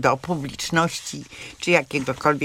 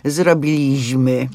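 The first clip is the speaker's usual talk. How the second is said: „Zrobiliśmy” z akcentem na przedostatniej sylabie: zrobiLIŚmy; błędny akcent; 3 semitones lower, 4 dB louder, slower than usual. Shown as a demonstration. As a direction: powoli pokaż gwarowe „zrobiLIŚmy”, z mocnym LIŚ. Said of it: "nie ma nic gorszego niż właśnie to powiedzenie". (przestroga przed gwarową formą „Zrobiliśmy”, akcent na LIŚ)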